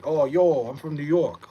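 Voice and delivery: mock loud New York accent; faked New York accent. Mocking people who fake being from New York, hammed-up New York accent. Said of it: "people that have a New York accent are faking it".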